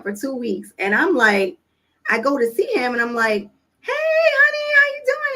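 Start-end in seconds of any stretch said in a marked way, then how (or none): 3.8-5.3 high, singsong mom greeting; cheery greeting. Retold or imitated. Mom greets her son at camp after two weeks away, cheery and high. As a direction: stretch out a bright, very high hello.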